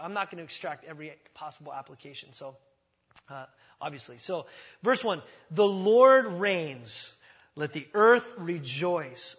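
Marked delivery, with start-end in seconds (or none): 5.5-9.4 loud, slow scripture declaration; solemn proclamation. Acted. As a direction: proclaim it to the whole room, slowly.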